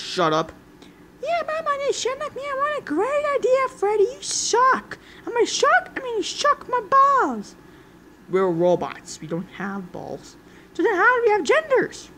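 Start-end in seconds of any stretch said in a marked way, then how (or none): 1.2-7.6 very high squeaky mocking voice; mock them in a squeaky voice. 10.7-12.1 very high squeaky mocking voice; mock them in a squeaky voice. Same squeaky voice.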